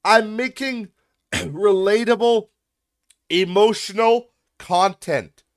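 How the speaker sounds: slow, high, loud mock quote; mocking shout. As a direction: shout it back slowly, in ridicule. Mockingly shouts back the comment.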